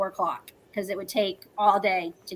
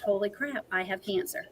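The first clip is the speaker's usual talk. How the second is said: much softer, sinking retold thought; hushed realization; 8 dB quieter than usual. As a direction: drop the volume as the truth sinks in. Retold moment of realizing the diagnosis.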